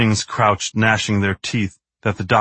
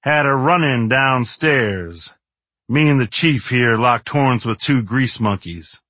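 slow, higher cowboy drawl; easygoing movie cowboy; 3 semitones higher, slower than usual. Acted. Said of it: "in his drawling cowboy actor's voice".

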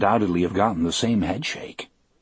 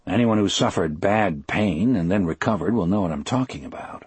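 usual speaking voice

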